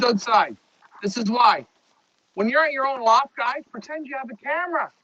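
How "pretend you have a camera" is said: ordinary talk, nothing marked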